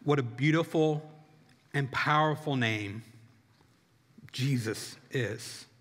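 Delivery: low, hushed, reverent prayer voice. Real, not acted. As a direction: lead them softly into prayer, pitched low. Begins closing prayer.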